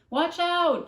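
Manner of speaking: loud high cry through the window; shouting a warning. A narrator's reading. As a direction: yell to stop the danger. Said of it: "she shouted".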